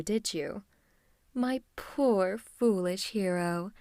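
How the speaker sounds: slow, drawn-out villain taunting; gloating villain. Acted. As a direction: savour each word over your captive. Villain taunts captured hero.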